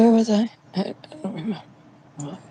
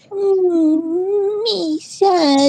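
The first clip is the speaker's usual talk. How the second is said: put-on much higher, babyish pout; mock sad baby voice; 11 semitones higher, much slower than usual. Mocking Kyra's sadness with a high pouty baby voice. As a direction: pout like a sulking child.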